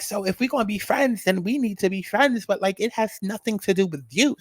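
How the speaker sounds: put-on sassy New York accent, talking her down. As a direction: Cleopatra in a light New York accent, smoothing things over. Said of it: "y'all know the New York accent".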